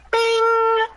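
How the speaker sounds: high bright navigation chime; ding like a sat nav alert; GPS chime. Pretend. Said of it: "my impression of a G P S".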